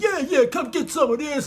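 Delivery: high-pitched trash talk; taunting. Acted. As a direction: dare him to come and fight. Goliath taunting David to fight.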